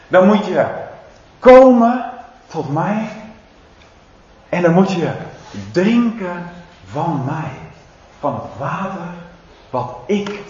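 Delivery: ordinary delivery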